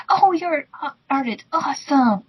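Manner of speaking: ordinary talk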